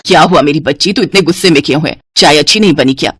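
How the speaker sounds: doting mother, pitched low; concerned mother. Acted.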